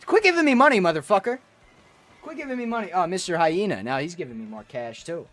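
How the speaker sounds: usual speaking voice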